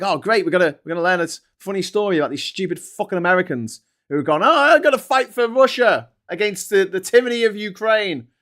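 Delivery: high, loud mock excitement; mocking viewers. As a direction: ham up the gleeful audience. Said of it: "Everyone's like".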